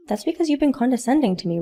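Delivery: ordinary talk, nothing marked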